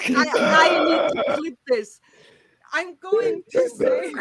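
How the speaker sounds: high, slow inhaled speech; speaking on the inhale. Shown as a demonstration. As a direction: drag each word in on the breath. Trying to talk breathing in.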